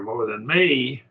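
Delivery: ME lifted in pitch for emphasis. Shown as a demonstration. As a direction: lean on the word ME, raising it. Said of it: "and I raise, just raise my voice a bit".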